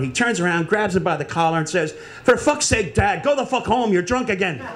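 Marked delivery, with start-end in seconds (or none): 2.2-4.6 fed-up, higher-pitched scolding; exasperated son. Acted. Son sending his drunk dad home from the bar, exasperated.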